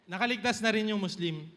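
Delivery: normal talking voice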